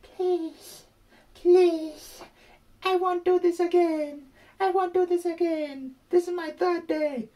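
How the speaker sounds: high, pleading, tearful begging voice; imitating crying granny. Scammer's granny begging in a high crying voice. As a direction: beg in a high wailing voice like a scared old lady. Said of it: "And she was crying".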